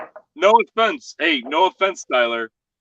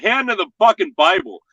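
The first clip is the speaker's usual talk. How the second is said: emphatic oath; 7 semitones higher, faster than usual. Real, not acted. Swearing to friends he thought so. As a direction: insist hard he means it.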